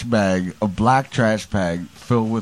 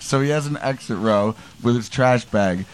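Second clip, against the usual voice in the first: ordinary delivery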